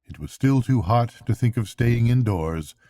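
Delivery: ordinary delivery, nothing marked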